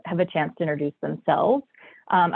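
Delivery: normal talking voice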